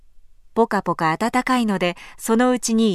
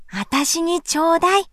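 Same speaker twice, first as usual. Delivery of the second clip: put-on begging child; 7 semitones higher than usual. A narrator's reading. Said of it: "せがみました".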